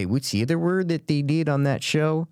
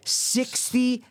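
"Sixty" pitched up high and punched; stress on sixty; 12 semitones higher than usual. Real, not acted. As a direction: hit "Sixty" hard to shock. Stressing the surprising number.

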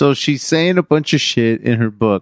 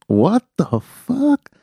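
stunned disbelief; 9 semitones higher, much slower than usual. Real, not acted. Learns [name]'s baby daddy rumor.

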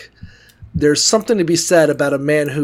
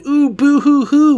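fake crying; 9 semitones higher, 3 dB louder, slower than usual. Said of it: "is like".

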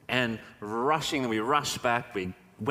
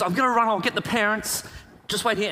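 urgent friend; 7 semitones higher, 4 dB louder than usual. Retold or imitated. Friend hurries off for parents.

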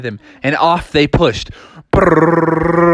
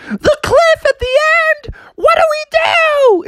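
high squeaky panic. Acted. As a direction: shriek it high in cartoon fright. Sister horrified.

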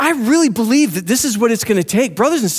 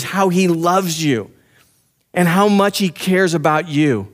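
ordinary delivery

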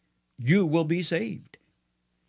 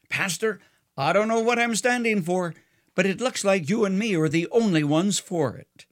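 higher-pitched, mildly sheepish reply; 6 semitones higher than usual. A narrator's reading. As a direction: answer a little sheepishly, voice lifted. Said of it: "and sheepishly said".

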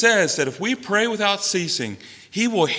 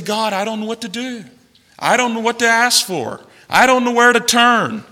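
crying out to God; 6 semitones higher than usual. Acted. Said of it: "to cry out to God".

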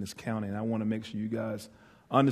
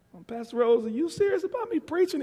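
anxious question. Retold or imitated. His anxious question to pastor.

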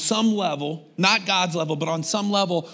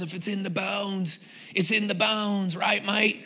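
bad British accent; 2 semitones higher, 4 dB quieter than usual. Preacher quoting a rock guitarist to church, bad British accent. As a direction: do a badly faked British accent. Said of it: "That's a bad British accent".

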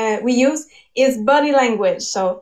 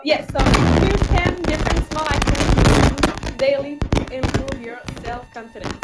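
normal talking voice

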